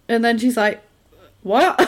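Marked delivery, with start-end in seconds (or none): 1.4-1.9 incredulous. Retold or imitated. Wife incredulous.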